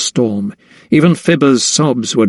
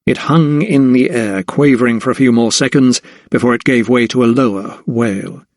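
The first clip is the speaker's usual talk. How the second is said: plain reading voice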